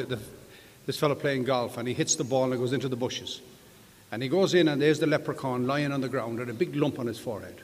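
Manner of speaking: usual speaking voice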